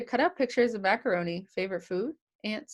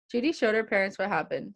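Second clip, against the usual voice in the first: plain reading voice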